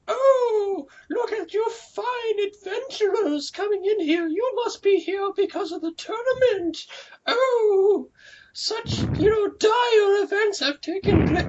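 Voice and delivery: high, slow barmaid falsetto; bar wench voice. Retold or imitated. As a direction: play the flirty tavern wench. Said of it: "talk as, like, you know, a bar wench".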